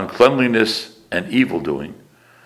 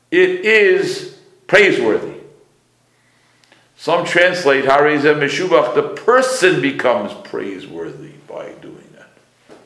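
high, raised teaching voice, key words drawn out; emphatic preaching; 7 semitones higher, 5 dB louder than usual. Real, not acted. Teacher expounding praiseworthy telling of the Exodus, emphatic preaching. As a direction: proclaim it high and slowly to the room.

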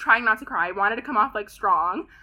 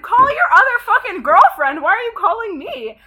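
loud, high furious snap; angry retort; 10 semitones higher, 7 dB louder than usual. Retold or imitated. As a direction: tell him off before hanging up. Hanging up on her ex.

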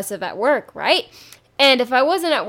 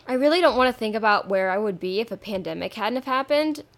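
ordinary talk, nothing marked

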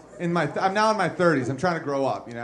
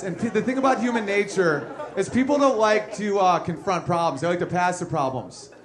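ordinary talk

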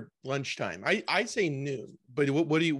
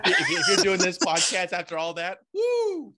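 mock cheer; 6 semitones higher, 6 dB louder, slower than usual. Real, not acted. Host cheers mock-impressed.

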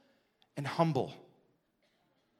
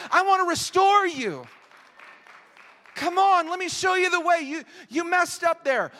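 much higher, louder urgent calling out; fervent plea; 10 semitones higher, 10 dB louder than usual. Acted. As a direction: call the brother back with all your heart. Voicing caring spiritual mothers/fathers.

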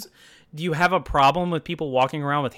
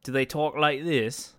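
slow, tentative British accent; softer, drawn-out British accent try; attempted British accent; 5 dB quieter than usual. Shown as a demonstration. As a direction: have a halting go at British. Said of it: "let's do a British accent".

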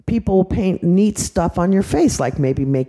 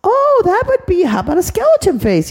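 much higher, sing-song lady voice; painter lady voice; 13 semitones higher, 3 dB louder than usual. Acted. Face painter lady answering the little boy, high and chirpy. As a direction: chirp it like a cheerful helper.

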